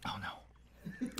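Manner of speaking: breathless whispered dread; pained whisper. Real, not acted. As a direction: whisper it in burning pain. After eating hot sauce.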